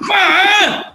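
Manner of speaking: high, loud playful shout of Ma; mock yell for mom. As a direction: call out to mom like the son. Joining the son's yell.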